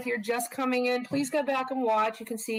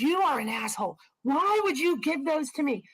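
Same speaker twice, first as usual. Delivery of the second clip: mock angry scolding, a bit higher; telling off; 5 semitones higher than usual. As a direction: retell a friend chewing you out, lightly. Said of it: "and just telling me off".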